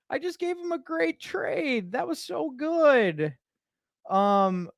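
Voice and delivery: high-pitched, gleeful fake bewilderment; mock dismay. Prankster to chat about the upset staffer, high mock dismay.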